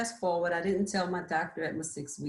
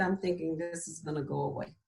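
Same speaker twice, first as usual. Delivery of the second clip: ordinary talk, nothing marked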